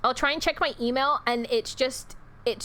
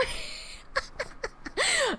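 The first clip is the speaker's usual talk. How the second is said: breathy laugh; 6 dB quieter than usual. Real, not acted. Laughing at chat's mixup.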